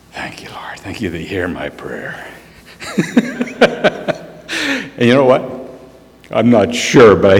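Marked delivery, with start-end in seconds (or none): 0.1-2.3 breathless whispered prayer, much softer; whispered thanks. Retold or imitated. Fisherman whispering thanks to the Lord on the riverbank. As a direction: whisper your thanks to God.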